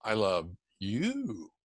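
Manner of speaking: low, soft and slow, lingering on YOU; weight on YOU. Shown as a demonstration. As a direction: ease down gently and stretch out YOU. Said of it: "inflection or emphasis is different".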